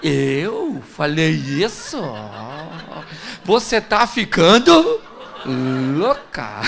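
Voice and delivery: slow, drawn-out innocent denial; cynical denial. Retold or imitated. Husband denying to his wife what he said, cynical and slow. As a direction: play dumb with a smirk. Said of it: "com a cara mais cínica do mundo".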